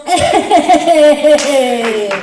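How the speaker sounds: loud, high, long-held cheer; celebratory whoop. Real, not acted. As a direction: shout it out in triumph. Cheers at her own reveal.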